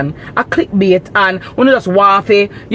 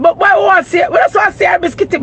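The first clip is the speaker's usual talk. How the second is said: mocking commenter; 8 semitones higher, faster than usual. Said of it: "mimicking the commenter".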